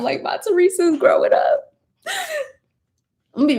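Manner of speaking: high-pitched pretend weepy gushing; mock tearful. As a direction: wail it playfully, as if tearing up. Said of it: "gonna be crying the whole time".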